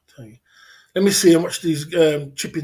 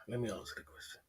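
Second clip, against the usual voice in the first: low, quiet mutter under breath; muttered to self; 4 semitones lower, 16 dB quieter, faster than usual. Real, not acted. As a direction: say it quietly to yourself. Repeated to himself muttering.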